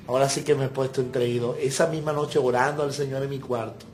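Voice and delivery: usual speaking voice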